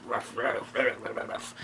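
soft, low mock barking; little muted ruffs under the breath; imitated dog barks.

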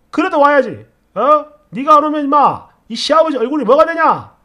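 loud, high-pitched insistent rebuke; scolding father-in-law. Acted. Father-in-law worried about saving face, loudly berating her. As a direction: hammer the demand home angrily. Father-in-law's repeated demand acted out.

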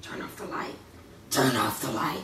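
possessed demon voice. Pretend. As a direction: speak like something is inside you. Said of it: "Like he possessed and got a demon in him".

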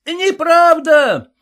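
loud high drawn-out yell; heckler's loud cry; shout. A narrator's reading. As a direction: shout it down from the second row. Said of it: "крикнул кто-то".

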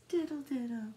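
ordinary delivery

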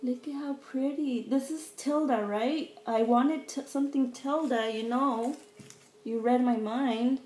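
real thrilled praise; gushing excitement. Real, not acted. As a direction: gush with full-voiced gratitude. Gushes to gift giver.

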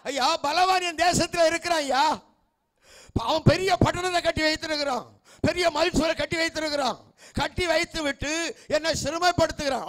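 high-pitched, urgent appeal; acted cry of complaint from the pulpit; impassioned preaching plea. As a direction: plead your case aloud before God. Acts out complaint to God.

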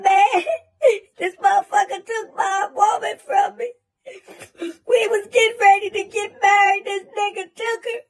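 a hammed-up blubbering sob with a laugh breaking through; mock crying. Imitating the heartbroken friend, hammed-up crying. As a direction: whine it out like a grown man crying. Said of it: "this motherfucker start crying".

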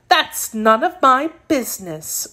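a touch of growl, dismissive. A narrator's reading. Kitten shrugging off the princess's accusation, growling. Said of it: "growled the kitten".

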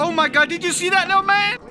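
loud, high, fast exclamation; excited shout. Real, not acted. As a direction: call out in amazed excitement. Exclaiming at a sight in game.

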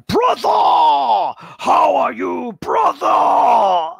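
loud gung-ho soldier; Space Marine voice. Shown as a demonstration. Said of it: "The Space Marine voice".

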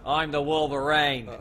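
hammed-up Australian hero voice; Australian accent. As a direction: drawl it in a broad Australian accent. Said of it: "Do you want it in my Australian voice".

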